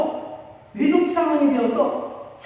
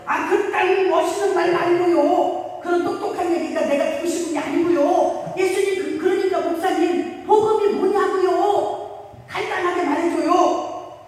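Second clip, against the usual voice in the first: 높은 목소리로 답답해 보채듯; 목사님께 한마디로 말하라고 떼쓰듯; 조르듯 따지기; 6 semitones higher, 3 dB louder than usual. Retold or imitated. 권사님이 조르며 따짐. (높은 목소리로 목사님께 답답해 조르기)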